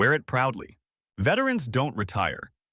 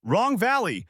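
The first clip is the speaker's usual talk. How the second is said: higher-pitched, mildly raised call; narrated yell; 9 semitones higher than usual. A narrator's reading. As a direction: call out the mistake in a high voice. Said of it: "Then someone yells".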